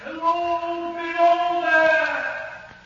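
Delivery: high, long-drawn call, softened by distance; distant crying-out voice. Acted. Voice the signalman heard one moonlight night, distant drawn-out cry. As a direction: call slowly from far off, stretching each word. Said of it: "I heard a voice cry out".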